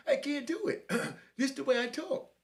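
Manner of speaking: high falsetto mimicry; no-bass effeminate voice. Young inmate insisting this is his natural voice, high and effeminate. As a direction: put on a high, girlish man's voice. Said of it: "Let me hear some bass in your voice".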